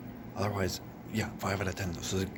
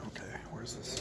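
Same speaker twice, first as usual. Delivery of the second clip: whisper; 13 dB quieter than usual. Real, not acted. Whispering inside the cinema.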